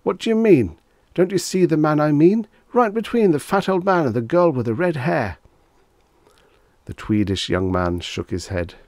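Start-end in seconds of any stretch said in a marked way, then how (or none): 0.0-5.4 much higher, louder frantic insisting; desperate shout. Acted. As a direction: plead loudly over the noise.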